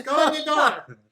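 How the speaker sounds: high, loud little gnome order; chirpy order to go inside. Acted. GM voices gnome.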